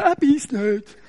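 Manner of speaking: breezy dog owner reassuring everyone; mock dog owner. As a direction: toss it off like a careless dog owner. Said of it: "wie die Hundebesitzer". (wie ein sorgloser Hundebesitzer, leicht gespielt)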